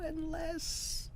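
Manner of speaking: unless said in a high, squeaky voice; high squeak. Shown as a demonstration. Narrator perking up at an idea, squeaky. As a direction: squeak unless out with sudden hope.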